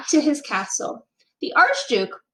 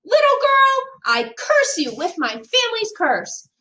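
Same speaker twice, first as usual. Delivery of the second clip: furious shout; 11 semitones higher than usual. A narrator's reading. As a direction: hurl the curse at her. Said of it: "hurled the box out the window and shouted".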